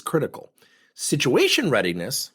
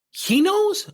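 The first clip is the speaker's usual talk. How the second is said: high, louder "he knows?" in disbelief; surprised parent; 4 dB louder than usual. Retold or imitated. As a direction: gasp it out as the news lands.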